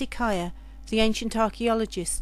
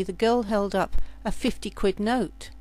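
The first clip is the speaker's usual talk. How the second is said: ordinary delivery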